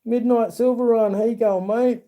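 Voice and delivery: high, bright call-out to a viewer; cheery greeting. Real, not acted. Greeting a chat viewer by name, high cheerful call-out.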